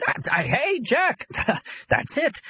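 very high, breakthrough exclamation; stammer, then burst out with the idea; excited stutter. A narrator's reading. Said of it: "Arnie stuttered".

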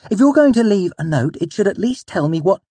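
high, loud, indignant protest; angry scolding. A narrator's reading. Still berating him, cut off.

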